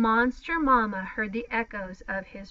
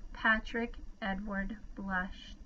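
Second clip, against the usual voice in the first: ordinary delivery, nothing marked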